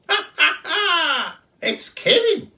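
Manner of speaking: very high, loud cackling bird voice; kookaburra laughing shout. A narrator's reading. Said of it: "shouted Colin".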